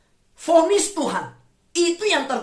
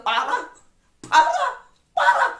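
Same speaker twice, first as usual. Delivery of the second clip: mocking repetition; 13 semitones higher, slower than usual. Mocking the doctor who keeps saying parah, much higher. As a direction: keep shrieking parah higher each time. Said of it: "ngomongnya parah terus aja".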